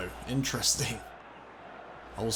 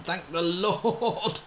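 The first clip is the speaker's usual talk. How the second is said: slow, high, laughing thanks; laughing relief; 8 semitones higher, much slower than usual. Real, not acted. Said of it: "relief after a save".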